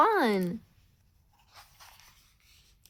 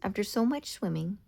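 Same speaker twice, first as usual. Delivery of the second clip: usual speaking voice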